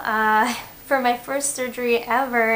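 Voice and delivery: usual speaking voice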